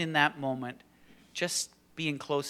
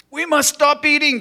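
high, loud cry of alarm; hammed-up desperate shout; 16 dB louder than usual. A narrator's reading. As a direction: cry it out like a panicking storybook toad. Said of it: "cried Toad".

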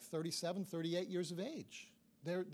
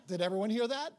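amazed outburst; 5 semitones higher, 8 dB louder than usual. Retold or imitated. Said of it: "And I'm going, like".

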